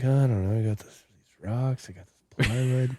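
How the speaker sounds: softly imitated thinking aloud; idle musing. Co-host playing an executioner sitting around, idle musing. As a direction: muse under your breath as an idle schemer.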